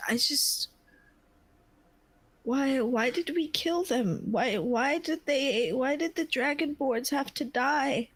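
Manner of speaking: starts in a whisper, then high and grieving; breaking into sobs. Acted. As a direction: she can't hold back the guilt any longer. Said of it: "And Edwell begins to sob".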